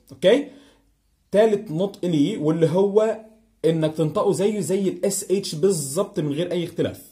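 ordinary talk, nothing marked

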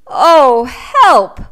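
loud, high frightened cry for help; scared cry; whimpering plea. A narrator's reading. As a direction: cry out in panic. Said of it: "whimpered the lion".

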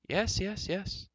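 high-pitched, impatient brush-off; dismissive interruption. Acted. Old enchanter cutting off a boastful prince, dismissive. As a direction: wave away his boasting. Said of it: "Dallben interrupted with a wave".

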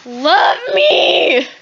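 drawn-out pleading shout; hammed-up needy yell; shouted mock demand. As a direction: holler it long at the cat. Demanding love from cat, escalating.

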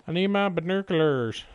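ordinary talk

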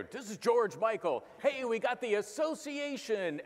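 high, slow radio DJ patter; a hammed-up seventies radio DJ, up high; old top forty DJ. DJ on the radio introducing the next song, hammed up. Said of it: "W F I L radio".

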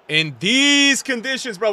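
high, loud, drawn-out complaint; exasperated wail, slow and high; loud exclamation. Real, not acted. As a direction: stretch it out in loud disbelief. Repeated catchphrase exclaimed over bad gameplay.

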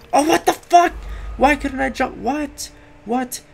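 shocked the jump failed; outraged surprise. Real, not acted. Jump fails in game.

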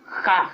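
triumphant ha. Real, not acted. Triumphant laugh.

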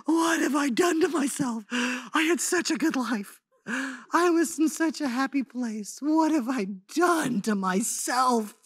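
slow, mournful self-questioning; mild mock despair, drawn out; despairing lament. Retelling her regret after moving home, slow despairing lament. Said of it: "I'm like".

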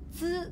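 つ said high and sharp; demo of つ. Shown as a demonstration. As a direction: pop out the sound つ clearly and high. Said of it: "難しいってよく言うよな". (「つ」を高く強調して)

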